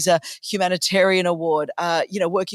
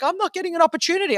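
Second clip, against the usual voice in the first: higher, fast mock whine; a lightly hammed-up whine; mock whiny complaint; 10 semitones higher, faster than usual. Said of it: "everyone's like".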